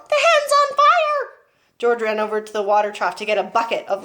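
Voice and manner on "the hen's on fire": alarmed shriek. Acted. Grandma alarmed.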